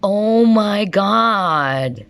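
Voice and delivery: very slow, high, drawn-out oh my God then wow; genuinely amazed, stretch every word; real astonishment, slowed right down; awed surprise. Real, not acted. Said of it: "I'm so enthusiastic".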